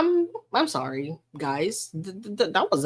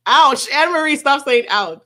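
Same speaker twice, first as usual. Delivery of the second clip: loud, high playful telling-off; yelp, then loudly tell them off; mock yelp and scolding; 5 semitones higher, 7 dB louder than usual. Streamer pretending to hit a viewer with a lozenge, loud mock scolding.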